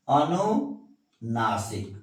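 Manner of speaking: अनुनासिक drawn out slowly, syllable by syllable; slow dictation. Shown as a demonstration. Teacher dictating अनुनासिक to students, slowly. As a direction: spell out अनुनासिक slowly for the class. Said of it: "अनु क्या है?".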